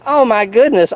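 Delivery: ordinary delivery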